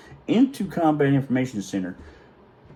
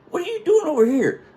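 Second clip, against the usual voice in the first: very high, astonished question; retold incredulous chief. Chief questions the sailor he just revived, incredulous. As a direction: act out a baffled demand for an explanation.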